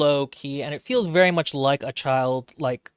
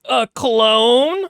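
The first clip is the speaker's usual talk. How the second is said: higher, louder gasp of disbelief; shocked crowd; 9 semitones higher, 7 dB louder than usual. Retold or imitated. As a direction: blurt it out scandalized. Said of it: "And everybody's like".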